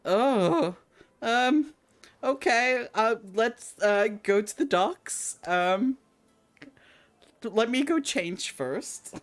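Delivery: put-on high, hesitant child voice; reluctant boy leader. Boy leader reluctantly agrees to docks, high voice. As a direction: stall like a nervous kid.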